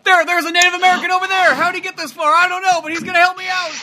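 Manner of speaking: high, loud hammed-up tourist panic; mock panicked tourist; clueless American tourist. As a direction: play a lost loud American.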